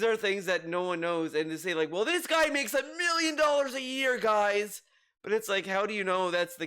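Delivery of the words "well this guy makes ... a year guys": high-pitched mock-indignant quote; mocking imitation. Host mimics a streamer griping about others' earnings, mockingly. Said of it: "in that kind of tone".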